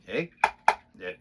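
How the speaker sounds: ordinary delivery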